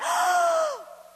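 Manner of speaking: sudden loud high gasp of realization. Retold or imitated. Mom gasps mid-chaos in the kitchen, loud high gasp. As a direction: gasp sharply, struck by an idea. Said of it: "and I went".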